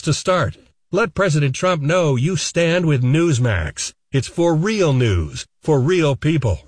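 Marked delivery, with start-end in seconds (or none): none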